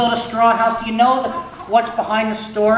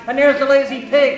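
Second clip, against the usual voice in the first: plain reading voice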